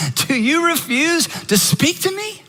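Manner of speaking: high-pitched, pressing questions from an exasperated governor; exasperated demand. Retold or imitated. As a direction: demand an answer, fed up and rattled. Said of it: "He finally is so exasperated".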